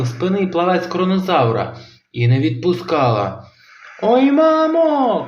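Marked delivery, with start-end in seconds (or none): 4.0-5.3 high, slow, loud cry of pain. A narrator's reading. Kronosaur bitten by grandma, high pained howl. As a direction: howl for mum. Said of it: "кричав кронозавр".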